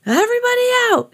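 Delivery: very high, fast lifeguard shout. A narrator's reading. Lifeguard ordering swimmers out, shouting. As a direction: clear the water, shout it. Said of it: "he shouted".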